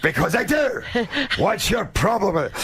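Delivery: mock defiant retort; defiant teen. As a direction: talk back like a bratty kid. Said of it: "And I".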